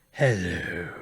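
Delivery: a quick grand impresario hello; Andrew Lloyd Webber impression. Teller repeating the Andrew Lloyd Webber hello. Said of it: "he sounds exactly like Paul F. Tompkins' impression".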